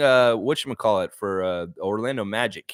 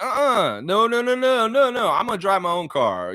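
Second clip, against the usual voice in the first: emphatic refusal; 9 semitones higher than usual. Acted. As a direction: refuse outright in a high voice, then settle. Acted refusal of self-driving car.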